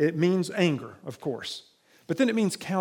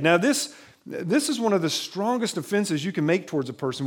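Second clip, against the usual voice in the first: normal talking voice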